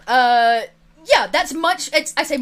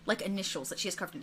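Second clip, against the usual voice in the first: ordinary delivery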